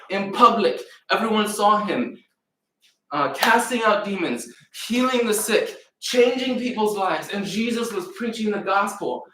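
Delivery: high, driving preacher's proclamation; rising preaching. Acted. Preacher rousing the congregation about Jesus' miracles, high and driving. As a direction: build the list up like a preacher.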